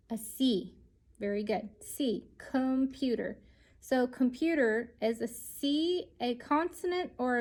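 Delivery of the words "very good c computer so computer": ordinary delivery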